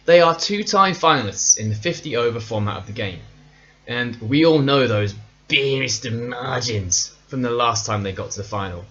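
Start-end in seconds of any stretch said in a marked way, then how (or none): 5.5-7.2 badly imitated New Zealand accent, drawn out; terrible New Zealand accent. Presenter quoting a famous line on New Zealand's final, terrible accent. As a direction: put on a bad, slow Kiwi accent. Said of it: "that was a terrible accent".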